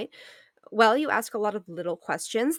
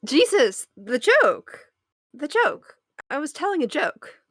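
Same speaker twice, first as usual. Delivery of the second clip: louder, higher exasperated push; 7 semitones higher, 7 dB louder, slower than usual. Acted. Girlfriend to boyfriend who missed the pun, exasperated. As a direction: make him get the joke.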